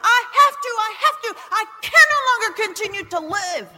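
desperate loud demand. Retold or imitated. Pounding fist on pastor's desk.

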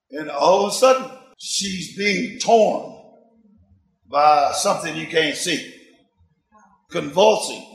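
normal talking voice

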